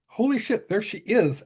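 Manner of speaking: high, hushed amazement; can't believe she's right there; starstruck gasp. Retold or imitated. Thinking as Caroline walks in, high starstruck awe.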